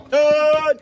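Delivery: high, loud call to the cows. Real, not acted. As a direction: call the herd in from afar. Calling cows into the shed.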